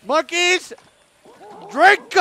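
high loud command; calling out. Real, not acted. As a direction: call the troop to drink. Calling out to monkeys.

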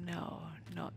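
whispering. Real, not acted. Sneaking quietly in the game.

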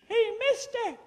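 squeaky high frog voice; talking frog. Acted. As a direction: pitch way up like a tiny frog. Talking frog calls old man.

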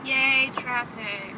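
very slow sarcastic cheer. Real, not acted. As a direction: drag it out, dripping sarcasm. Sarcastic cheer in traffic jam.

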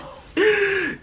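high-pitched burst of laughter. Real, not acted. Laughing at his own story.

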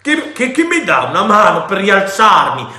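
loud, high, furious sputtering; wretched pirate grunting for help getting up; grunting angrily. A narrator's reading. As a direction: bark the demand, stumbling over chi. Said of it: "grugnì il miserabile".

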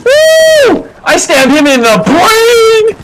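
high, loud victory whoop; triumphant cheer. Real, not acted. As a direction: whoop over a kill. Triumphant kill in battle.

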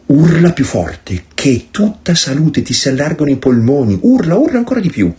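put-on mother goading a crying child, rising to high; imitating mother. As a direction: egg the tantrum on, teasing. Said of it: "mi diceva".

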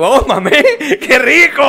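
high, laughing mock pleasure on "mamé"; co-host jokes about the inclusive word mamé, laughing; mock moan. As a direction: ham up the double meaning of "mamé".

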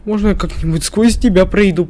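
ordinary delivery

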